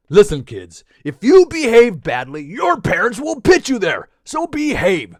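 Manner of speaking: hammed-up scolding; stern mock warning. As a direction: warn them like a finger-wagging parent.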